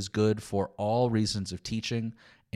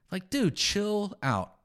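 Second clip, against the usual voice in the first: mock exasperation; 7 semitones higher, slower than usual. Preacher answers the imagined nitpicker.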